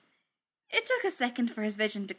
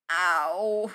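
loud, drawn-out ow; pained; 6 dB louder than usual. Acted. Tony hurting as he sits up, pained. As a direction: let the pain out on one long word. Tony hurt trying to sit up.

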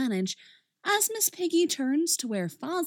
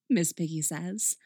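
ordinary delivery, nothing marked

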